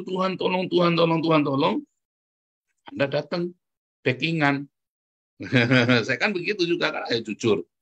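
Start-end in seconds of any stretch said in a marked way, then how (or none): 0.0-1.8 higher, rushed mock plea; hurried prayer. Preacher mimics believers crying out to God, hurried prayer. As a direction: chant it hastily, over and over. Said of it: "tapi terburu-buru Anda berdoa".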